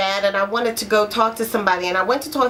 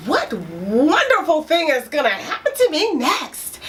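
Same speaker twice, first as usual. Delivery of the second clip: hopeful wondering; 4 semitones higher than usual. Pretend. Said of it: "you are not saying".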